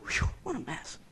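breathed aside, barely voiced; under the breath. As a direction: mutter it to yourself. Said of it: "we say it under our breath".

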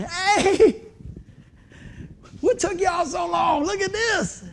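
high, hollered welcome; jubilant calling out. Acted. Pastor greeting loved ones arriving in heaven, jubilant calling out. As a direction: holler excitedly to latecomers far away.

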